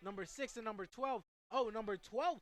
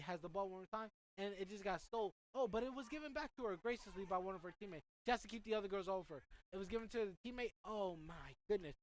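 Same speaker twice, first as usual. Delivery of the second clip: ordinary delivery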